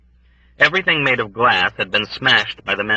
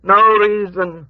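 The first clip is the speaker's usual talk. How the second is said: high, slow, louder wail; wailing old woman; 12 semitones higher, 6 dB louder, slower than usual. A narrator's reading. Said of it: "wailed the old woman".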